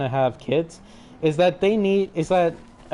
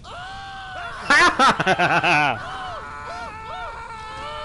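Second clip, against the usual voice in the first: high cackling laugh. Real, not acted. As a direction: burst into giggles. Laughing after tagging.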